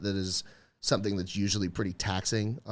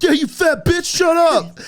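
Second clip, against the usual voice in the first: much higher and louder laughing crowd yell; crowd jeering; 12 dB louder than usual. Retold or imitated. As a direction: yell it gleefully like a whole crowd. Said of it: "people around her were like".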